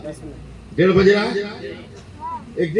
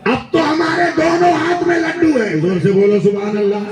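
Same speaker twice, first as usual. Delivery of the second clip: rousing preaching; 6 semitones higher than usual. Real, not acted. Preacher urging the gathering to shout praise, rising cry. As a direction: whip the crowd up to praise.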